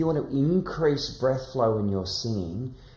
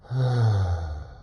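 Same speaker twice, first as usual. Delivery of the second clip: relaxed schwa; 3 semitones lower than usual. Shown as a demonstration. Teacher leads the class in relaxed recoil sounds. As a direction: let each "uh" drop out easily. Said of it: "slightly voiced recoil sound out".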